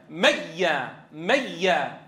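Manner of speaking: "ميا" as "mayya", pure doubled y without nasal hum; no ghunna. Shown as a demonstration. Teacher shows students a complete merger, no ghunna. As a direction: say "ميا" with a plain doubled y, falling. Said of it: "ننطق هكذا".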